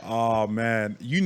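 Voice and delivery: ordinary talk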